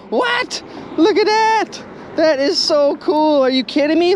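delighted at finding a necklace; thrilled squeal. Real, not acted. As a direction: gush over the jackpot find. Finds gold necklace, thrilled.

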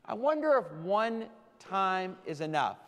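ordinary delivery

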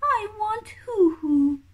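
high, whimpering chick wail; whiny crying chick. Acted. Baby kiwi begging mum for hoo hoo, high whimpering whine. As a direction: whine it like a hungry baby about to cry. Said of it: "says Hooey".

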